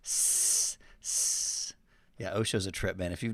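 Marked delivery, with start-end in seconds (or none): 0.0-1.7 long hissing s sound; hissed s. Shown as a demonstration. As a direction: hiss the S like Osho. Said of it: "he does a lot of S's".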